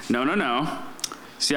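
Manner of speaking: ordinary talk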